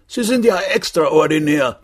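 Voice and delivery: high-pitched indignant guest; appalled guest. A narrator's reading. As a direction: protest in an affronted high voice. (entrüsteter Gast, hohe Stimme)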